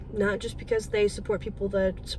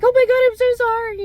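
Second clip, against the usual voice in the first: much higher and louder, flustered; startled apology; 13 semitones higher, 10 dB louder than usual. Retold or imitated. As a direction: blurt out a startled sorry. Bumping into a stranger's cart.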